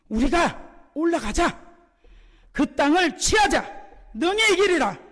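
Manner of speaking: high, loud, drawn-out declaration; rallying cry. Retold or imitated. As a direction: rouse the people with bold conviction. Preacher voices Caleb's rallying call.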